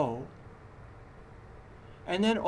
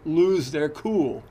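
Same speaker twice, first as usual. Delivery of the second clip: "lose their cool" louder and slowed, set apart as a quote; quoted phrase; 8 dB louder, slower than usual. Shown as a demonstration. Teacher marking the saying as a quote, slow and loud.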